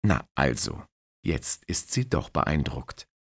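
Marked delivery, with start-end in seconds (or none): none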